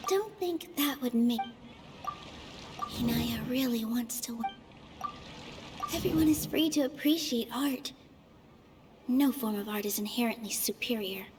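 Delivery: quiet inner voice. Acted. Nilou calming herself mid-debate, murmured inner voice. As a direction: talk it over under your breath.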